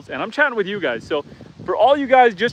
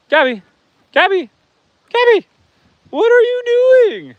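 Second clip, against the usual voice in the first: calling out, each call climbing higher and louder; calling the cow; 13 semitones higher, 3 dB louder than usual. Real, not acted. Farmer calling her cow Gabby, rising higher with each call. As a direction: sing out her name, rising a bit more every time.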